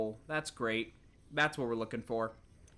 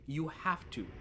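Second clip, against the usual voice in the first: normal talking voice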